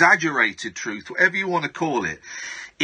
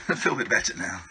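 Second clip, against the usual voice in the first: panting, laughing after exertion; winded from swinging nunchucks; out of breath; 4 semitones lower, 5 dB quieter, faster than usual. Real, not acted. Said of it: "Bit out of breath".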